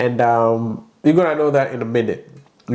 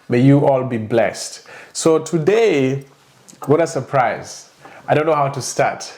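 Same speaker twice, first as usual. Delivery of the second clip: ordinary talk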